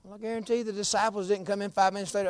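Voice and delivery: usual speaking voice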